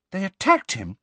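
high, startled question; aghast at the news; shocked. Acted. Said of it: "sounding shocked".

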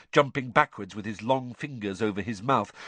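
ordinary delivery, nothing marked

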